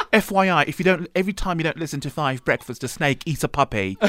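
hammed-up British apology; mock-sincere British accent, close to laughing; terrible British accent. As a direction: apologize in exaggerated British, then plug the show. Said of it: "The British accents".

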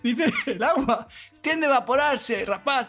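high, singsong Galician mother explaining; acento gallego. Retold or imitated. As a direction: imitate a Galician mother patiently giving a science lesson. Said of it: "acento gallego". (madre gallega que explica, voz aguda y cantarina)

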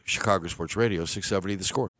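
usual speaking voice

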